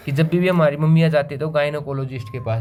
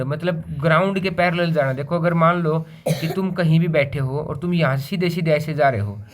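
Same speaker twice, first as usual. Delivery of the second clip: normal talking voice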